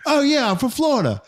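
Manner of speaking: very high mock British voice; British accent. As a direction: imitate a put-on British accent. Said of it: "Where did this voice come from?".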